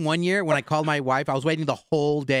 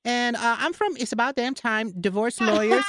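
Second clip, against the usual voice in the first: high-pitched put-on lawyer voice; silly high prank-call character; Nacho Chavez, chirpy prank caller; 8 semitones higher than usual. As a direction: pitch it way up as a phony divorce lawyer.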